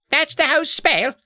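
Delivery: put-on very high British boy's voice; incredulous, squeaky mock-British question; put-on British accent. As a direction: redo it in a hammed-up British accent. Redoes Edward in character accent.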